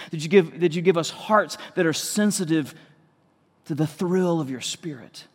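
earnest prayer, slowing and fading at the end; pastoral prayer. Acted. Pastor prays over congregation.

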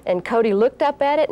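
usual speaking voice

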